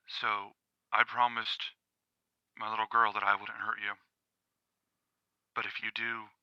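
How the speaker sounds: quiet threat. Acted. Father warning a dog not to harm his group, quiet threat. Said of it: "he says it real quiet".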